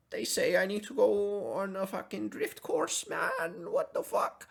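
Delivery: hammed-up Swedish accent, aggrieved; Jordan Peterson, Swedish accent. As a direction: protest in a silly Swedish accent. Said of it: "like, a Swedish accent for him".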